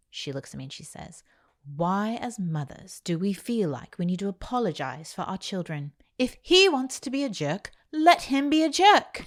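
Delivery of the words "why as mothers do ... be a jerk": put-on mentor's British accent, laughing and tearful at the end. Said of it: "Your British accents, like, for real".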